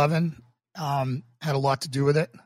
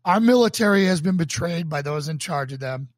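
earnest, emphatic sermon delivery, swelling louder on key phrases; sermon reading; 2 semitones higher, 3 dB louder than usual. Acted. Pastor reading his sermon to online viewers, earnest and emphatic. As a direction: preach it from the page with conviction.

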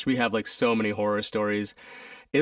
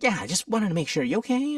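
higher, gentle mock of a worried stage manager; concerned stage manager; 9 semitones higher than usual. Stage manager checks on exhausted dancer, higher concerned voice. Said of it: "He's like".

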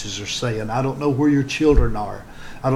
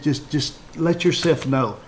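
ordinary delivery

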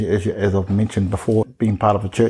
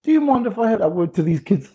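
raised, polite interrupting voice; polite request; 11 semitones higher than usual. Retold or imitated. Teacher asking the pastor to address the kids. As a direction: ask the pastor politely for the floor. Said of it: "I said".

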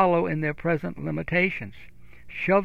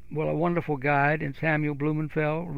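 normal talking voice

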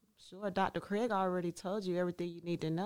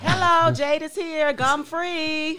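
loud announcement; 6 semitones higher, 14 dB louder, slower than usual. Real, not acted. Loud entrance announcing herself.

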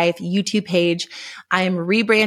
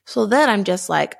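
ordinary talk

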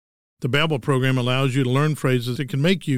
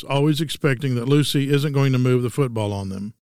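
normal talking voice

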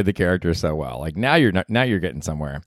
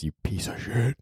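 muttered insult; 9 dB quieter than usual. Acted. Aside insult to imagined listener.

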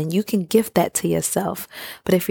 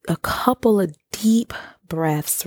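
breathy whisper, slow and hushed; whispered guidance; slower than usual. Shown as a demonstration. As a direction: whisper the breathing cue gently. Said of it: "guiding a breathing exercise".

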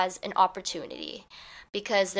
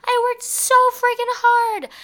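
high, loud, upset outburst; shrieked, acted-out complaint; screaming at them; 7 dB louder than usual. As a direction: scream an accusation at your partner. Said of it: "just start screaming at them".

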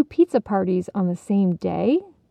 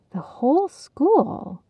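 slow, higher awed question; in wonder; 6 semitones higher, slower than usual. Acted. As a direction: breathe it in amazement. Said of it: "Heidi echoed in wonder".

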